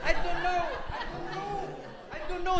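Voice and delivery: high, frantic self-questioning; a terrified man at death's door, high and pleading; panicked high pleading. Retold or imitated. Passenger facing death, asking himself in a high frantic voice. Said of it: "this man says".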